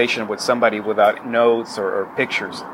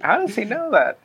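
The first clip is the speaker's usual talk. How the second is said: surprised; 11 semitones higher than usual. Retold or imitated. Imitating surprised self, high.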